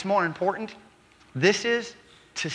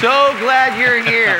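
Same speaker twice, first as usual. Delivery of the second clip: loud, slow, ringing welcome; big welcome; 4 semitones higher, 11 dB louder, slower than usual. Real, not acted. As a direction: boom it out to greet the guest. Pastor welcoming guest on stage.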